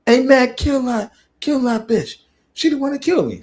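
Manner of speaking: high-pitched put-on character voice; squeaky-high mock urging; game character urging a kill. Voicing the character urging him on.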